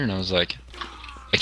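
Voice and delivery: ordinary talk, nothing marked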